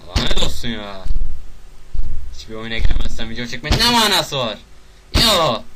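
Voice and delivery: loud, exasperated shouting; frustrated outburst. Real, not acted. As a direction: yell at the game that won't cooperate. Frustrated at a game that won't load.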